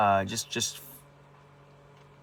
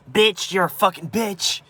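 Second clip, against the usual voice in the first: higher, louder mock scream of an insult; retold screaming; 9 semitones higher, 5 dB louder than usual. Retelling grandpa and mom fighting, mock screaming. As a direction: re-enact the screaming match, not full force. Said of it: "with screaming".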